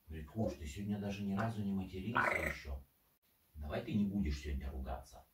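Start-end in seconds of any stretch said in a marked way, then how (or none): none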